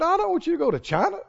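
tickled by the Lord's twist; laughing punchline. Retold or imitated. Preacher delivering the Lord's reply to the man, laughing.

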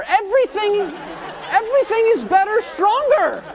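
high, loud, exuberant preaching; rousing climax. Real, not acted. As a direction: build to a big rallying cry.

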